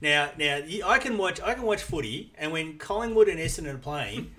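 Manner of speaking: ordinary delivery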